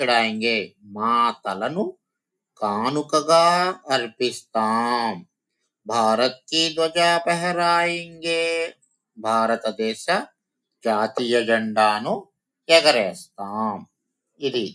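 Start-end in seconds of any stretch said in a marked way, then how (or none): 5.9-8.7 raised, sing-song recital of the line; poem recitation. Shown as a demonstration. Teacher reciting the poem line to her students, sing-song. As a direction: chant the verse to the class.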